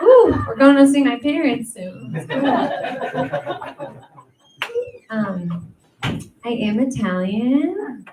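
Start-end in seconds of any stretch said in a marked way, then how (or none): 0.0-4.0 loud, high giggly excitement; laughing excitement. Real, not acted. Telling the audience about meeting parents, laughing. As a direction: gush with laughter.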